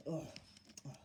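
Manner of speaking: ordinary delivery, nothing marked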